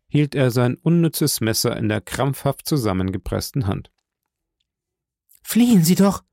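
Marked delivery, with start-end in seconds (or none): 5.4-6.2 high-pitched, urgent call; urgent cry. A narrator's reading. Pitying young lady urges the fugitive to run, urgent cry. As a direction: cry out to make him flee. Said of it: "rief Fräulein d'Armilly".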